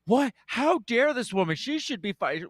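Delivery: high, indignant what; hammed-up shocked pearl-clutching; mock outrage. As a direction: Whoopi feigning outrage at the reporter. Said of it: "She's like".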